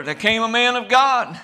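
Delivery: ordinary talk, nothing marked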